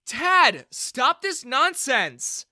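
loud, high, drawn-out order; angry scolding shout. A narrator's reading. Bella stops her dad's bullying, shouting angrily. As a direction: cut in with an exasperated yell. Daughter breaks up the quarrel.

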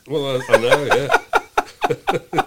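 laughing through words; giggling, high pitched. Real, not acted. Laughing at mask mishap.

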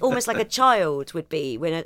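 ordinary delivery

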